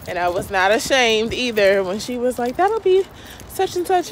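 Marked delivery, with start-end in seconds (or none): 2.6-4.1 cashier voice. Retold or imitated. Cashier quoted.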